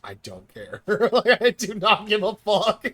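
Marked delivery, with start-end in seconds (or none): 0.8-2.9 laughing through the words; cracking up while swearing off caring. Real, not acted. Laughing defiance at shorts.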